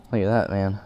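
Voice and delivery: usual speaking voice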